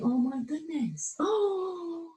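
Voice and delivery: softly amazed at a sudden discovery; awed wonder. Retold or imitated. Coach voicing a listener's amazement, soft and awed. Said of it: "an opening up of going".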